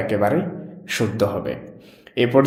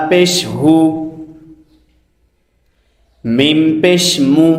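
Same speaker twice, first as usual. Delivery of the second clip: slow, measured teacher's chant; spelling drill recitation; 4 semitones higher, 4 dB louder, slower than usual. Shown as a demonstration. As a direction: recite each letter deliberately, vowel drawn out. Leads a letter-spelling drill.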